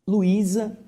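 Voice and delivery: ordinary delivery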